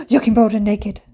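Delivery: re-enacted involuntary exclamation; sudden outburst. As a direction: gasp and blurt it out. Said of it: "I just literally burst out".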